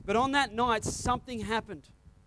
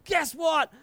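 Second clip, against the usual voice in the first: high, loud excited announcement; excited shout; 6 semitones higher, 5 dB louder, much slower than usual. Retold or imitated. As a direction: burst in with breathless news. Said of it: "I charged inside and said".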